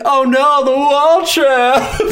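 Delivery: slow, loud mock horror; hammed-up dismay. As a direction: overplay the villain's defeat. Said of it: "he's like".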